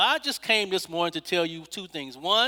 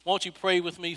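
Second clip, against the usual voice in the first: ordinary delivery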